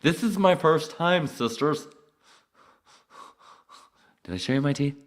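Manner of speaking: high, loud mock gushing voice; mock girly voice. Imitating a giddy first-time viewer, high loud gushing. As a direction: ham up a giddy fan.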